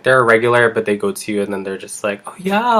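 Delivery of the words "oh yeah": high-pitched, put-on "oh yeah"; falsely agreeable lilt; fake friendly client. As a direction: imitate insincere sweetness, pitched way up. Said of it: "by fake, I mean".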